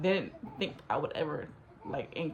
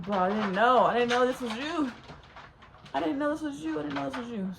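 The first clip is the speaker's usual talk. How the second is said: rising, higher-pitched repeated protest; retold going off; 4 semitones higher than usual. She argues back at her accusing catfish, going off. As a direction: protest over and over, pitch climbing. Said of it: "We going off, we're going at it".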